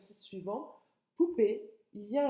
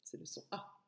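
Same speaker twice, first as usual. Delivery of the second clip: whispered; 3 semitones lower, 12 dB quieter than usual. Shown as a demonstration. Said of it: "teacher explaining sounds".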